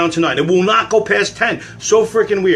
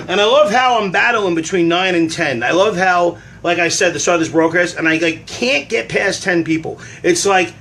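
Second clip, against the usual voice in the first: ordinary delivery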